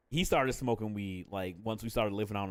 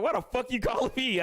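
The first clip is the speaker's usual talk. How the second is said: annoyed mom; 12 semitones higher than usual. Retold or imitated. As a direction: snap at the kid like a busy mom at work. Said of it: "She'd be like".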